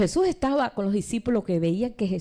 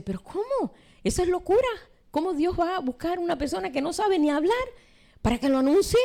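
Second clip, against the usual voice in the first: high-pitched, baffled objection; incredulous; 7 semitones higher than usual. Acted. Doubter questioning God choosing a stutterer as spokesman, incredulous. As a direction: question it as if it were madness. Acted disbelief at God's choice.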